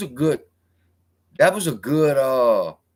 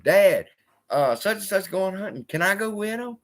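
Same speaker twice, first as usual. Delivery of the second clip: put-on higher, pleading little boy asking permission; kid self asking dad; 9 semitones higher than usual. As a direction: play yourself as a kid begging your dad, pitched up. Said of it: "I said".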